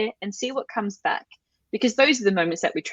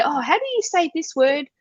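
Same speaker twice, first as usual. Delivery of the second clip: higher-pitched acted curiosity; curious question; 8 semitones higher than usual. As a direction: play a curious learner asking for help. Acts out learner asking crew.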